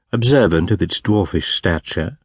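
ordinary delivery, nothing marked

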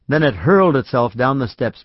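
plain reading voice

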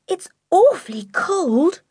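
high, slow, drawn-out complaint; cold young girl. A narrator's reading. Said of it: "her teeth beginning to chatter".

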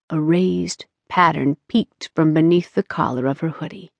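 ordinary delivery, nothing marked

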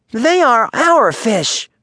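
high, slow, fierce. A narrator's reading. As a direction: spit it out fiercely. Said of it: "said Little Joe fiercely".

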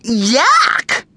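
high cry of disgust. A narrator's reading. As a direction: cry out, grossed out. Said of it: "cried Mandy".

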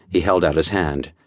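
ordinary delivery, nothing marked

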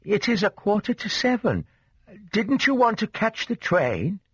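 high-pitched motherly questioning; light, high voice of a concerned mother; soft-voiced mother. A narrator's reading. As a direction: gently chide in a high mother's voice. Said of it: "What a soft voice!".

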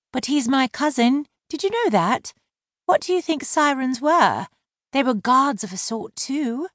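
high-voiced mermaid mocking his slur; wry mermaid. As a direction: let the sarcasm sit lightly. Laurel arguing.

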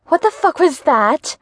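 a startled what the fuck; startled exclamation. Acted. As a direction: jump at a twig snapping. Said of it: "she exclaimed".

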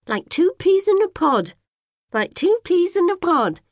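put-on high, repeating old lady voice; echoing neighbour. A narrator's reading. Neighbour's voice echoing in the girl's head, high and repeating. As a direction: play it like a jumping record in the head. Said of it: "Missus Dunkerley's voice saying".

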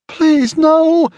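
very high, loud cry of terror; high-pitched frantic plea; desperate pleading. A narrator's reading. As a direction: beg in sheer panic. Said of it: "she pleaded".

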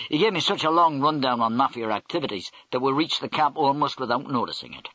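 plain reading voice

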